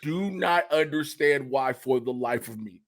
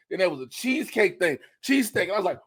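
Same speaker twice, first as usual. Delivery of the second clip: ordinary talk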